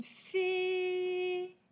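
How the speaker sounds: FÉE held long on a high é; elongated vowel. Shown as a demonstration. Teacher lets pupils hear é, "fée" held high. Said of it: "C'est le son et".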